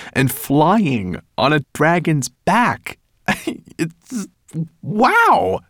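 put-on breathless wow of a dazzled kid; excited boy. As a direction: let the wonder burst out. Ben dreams of dragon flight.

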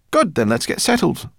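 loud, high, cheerful burst; happy. A narrator's reading. Professor settling plans with new family, happy. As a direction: round it off gladly. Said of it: "said the professor happily".

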